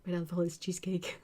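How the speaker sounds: whisper. Real, not acted. Reading food comments on stream.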